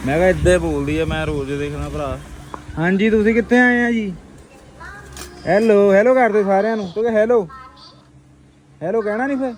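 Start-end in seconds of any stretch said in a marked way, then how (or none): 2.8-9.5 playful teasing. Real, not acted. Teasing a visitor, hello.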